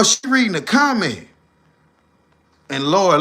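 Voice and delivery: ordinary talk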